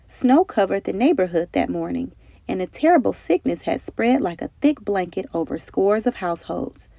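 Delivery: ordinary delivery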